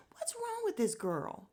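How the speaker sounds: high pitched disbelief; high, baffled outcry; exasperated squeal. Real, not acted. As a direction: squeak it out in frustration. Exasperated at Kayla.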